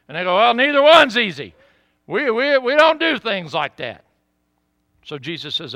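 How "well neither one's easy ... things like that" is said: high, loud mock objection; blustering, raised-voice protest; protesting skeptics. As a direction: imitate flustered scribes objecting.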